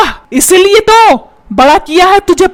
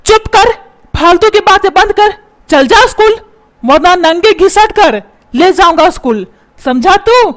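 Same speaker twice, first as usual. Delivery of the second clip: higher-pitched, insistent father's tirade; angry scolding; 4 semitones higher than usual. Acted. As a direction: threaten the son, then berate his mother. Father's threats, turns on wife.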